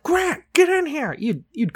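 high, drawn-out dad holler; dad calling. Acted. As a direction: summon the kid like a dad at night. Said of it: "your dad was like".

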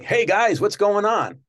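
breezy Jay greeting. Retold or imitated. Guest imitating Jay greeting the crew, excited. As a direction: greet everyone like old pals. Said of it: "With Jay, it's like".

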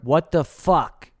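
slow, loud put-on alien voice. Aliens angry at the drug war, slow put-on alien voice. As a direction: drag it out in a strange alien voice. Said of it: "They're like".

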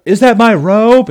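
high, loud, slow incredulous question; mock amazed king squinting; squinting disbelief. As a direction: act out stunned Saul. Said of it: "he squints and he's like".

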